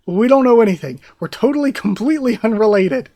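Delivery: high, loud frantic denial; panicked denial. Acted. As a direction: blurt it out, terrified of blame. Guards denying to Lady Witch.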